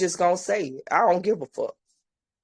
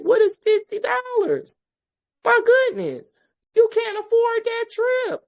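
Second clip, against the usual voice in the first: incredulous outburst. Real, not acted. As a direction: voice jumps way higher in disbelief. Exasperated rant about fifty dollars.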